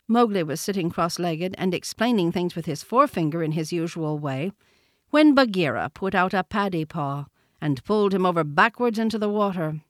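usual speaking voice

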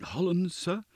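high, timid little answer; trembling sexton. A narrator's reading. Sexton replies to his goblin questioner, trembling. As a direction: answer meekly in a high nervous voice. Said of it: "trembling more than ever".

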